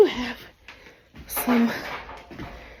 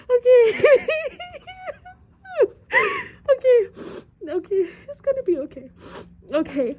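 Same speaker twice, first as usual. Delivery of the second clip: crying laugh; 11 semitones higher, 7 dB louder than usual. Real, not acted. Overwhelmed by roof damage.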